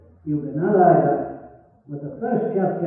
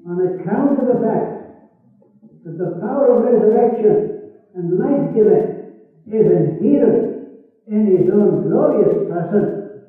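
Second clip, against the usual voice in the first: climactic preaching; 2 semitones higher, 5 dB louder than usual. Acted. Preacher proclaiming eternal life to listeners, rising chanted fervour. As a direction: build the sermon to its peak.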